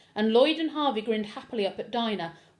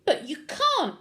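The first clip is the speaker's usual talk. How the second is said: sudden higher protest; boy protesting, higher and louder; bursting out; 7 semitones higher, 4 dB louder, slower than usual. A narrator's reading. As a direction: blurt it out in dismay. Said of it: "burst out Harvey".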